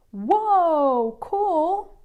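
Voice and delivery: high, excited whoa; a kid's wide-eyed delight. A narrator's reading. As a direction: Emilio reacts to the photo, high and excited. Kid sees the photograph.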